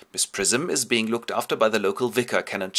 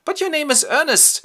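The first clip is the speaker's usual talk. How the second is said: far higher and louder disbelief; astonished; 6 dB louder than usual. Acted. Algy amazed his friend's name is not Ernest, astonished. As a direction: catch him out. Algy catches John's lie.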